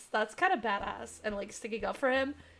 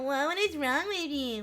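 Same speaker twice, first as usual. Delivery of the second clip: whiny nasal mock of the line; nasally mocking voice; 2 semitones higher, slower than usual. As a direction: parrot it in a nasal whine. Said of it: "in a nasally voice".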